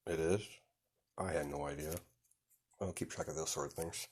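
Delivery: low, very soft murmur; hushed reply. Acted. Son quietly admits forgetting mother's birthday, hushed. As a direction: answer quietly under your breath.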